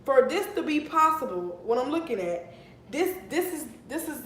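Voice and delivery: ordinary talk, nothing marked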